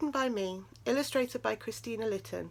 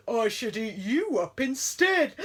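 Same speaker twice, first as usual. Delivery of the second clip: menacing bear; 2 semitones higher, 7 dB louder than usual. A narrator's reading. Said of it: "the bear considered".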